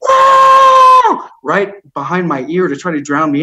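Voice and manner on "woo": loud, very high yelled woo; shrill woo. Retold or imitated. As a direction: whoop right in someone's ear. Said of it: "a volunteer there who was just yelling".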